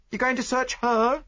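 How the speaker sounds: high, loud pointed demand. Acted. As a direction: push the question at him. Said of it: "she demanded".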